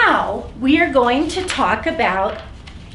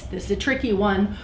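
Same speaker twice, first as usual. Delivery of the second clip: ordinary delivery, nothing marked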